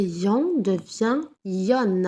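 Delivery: the final "yon" said with the N sounded; slow sound demo. Shown as a demonstration. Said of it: "Doublé ou le N".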